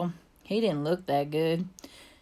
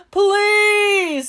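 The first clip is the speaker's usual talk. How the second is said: high, loud, drawn-out wail; tearful plea; 12 semitones higher, 8 dB louder than usual. A narrator's reading. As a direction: wail it as the overwrought manager. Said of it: "he started to cry".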